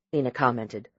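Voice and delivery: usual speaking voice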